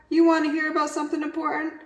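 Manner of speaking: high, fast chatterbox. Acted. Yak chatters at Walrus, high and fast. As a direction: rush it out eagerly.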